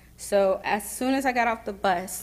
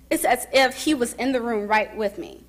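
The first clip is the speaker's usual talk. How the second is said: normal talking voice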